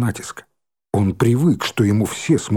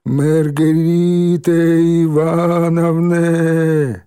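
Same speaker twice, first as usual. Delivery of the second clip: drawn-out bleating like sheep; 6 semitones higher, 3 dB louder, much slower than usual. A narrator's reading. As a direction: bleat the name, slow and long. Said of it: "понеслось блеяние".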